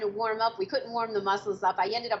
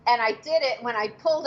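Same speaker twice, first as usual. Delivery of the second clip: normal talking voice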